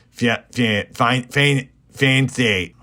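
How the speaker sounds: stuttering attempts at "fiancé", restarting on the first syllable; stumbling pronunciation. Red cannot say her marital word, stumbling pronunciation. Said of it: "How do you say that?".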